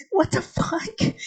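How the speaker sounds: high-pitched, slow, hushed disbelief; incredulous. Real, not acted. Baffled by Beethoven in anime.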